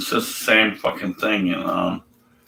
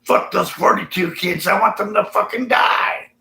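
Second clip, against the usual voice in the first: higher, spiteful mock prophet voice; 11 semitones higher than usual. Skeptic mocks Elisha cursing the kids, spiteful high sneer. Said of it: "he says".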